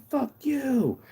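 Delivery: high, drawn-out singsong; high-pitched playful swearing; mock curse. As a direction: sing out the insult, not shouted. Mock curse at waving neighbors.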